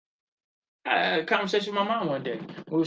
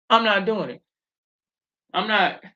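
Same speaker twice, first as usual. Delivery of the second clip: louder and higher, dead set against it; half-laughing, firm and raised; emphatic refusal; 3 semitones higher, 5 dB louder than usual. Real, not acted. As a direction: say it like nobody can change your mind. Repeats refusal, louder second take.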